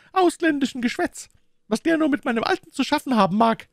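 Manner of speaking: high, grumbling woman's character voice; distrustful boatman's wife. A narrator's reading. Wife grumbles about her husband's suspect visitor, high distrustful voice.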